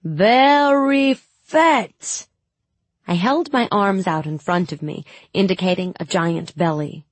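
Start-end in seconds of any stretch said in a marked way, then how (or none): 0.0-2.3 high, loud, very slow fake Suli accent; awful Suli accent. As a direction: ham up a dreadful Suli accent. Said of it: "My accent was even worse".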